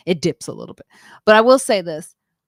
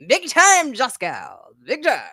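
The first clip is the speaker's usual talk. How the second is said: hype shout; 9 semitones higher, 4 dB louder than usual. Real, not acted. Hyping up arriving guest.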